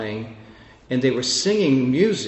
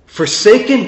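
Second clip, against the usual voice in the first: forsaken louder and higher, punched hard; emphatic repeat; 8 semitones higher, 8 dB louder than usual. Acted. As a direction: hammer the word forsaken home.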